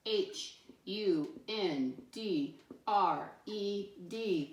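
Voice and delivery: letters spelled out slowly one by one; slow letter-by-letter spelling. Shown as a demonstration. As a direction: spell it slowly for little ones. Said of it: "spelling for the class".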